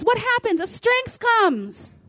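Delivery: usual speaking voice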